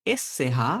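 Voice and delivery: ordinary delivery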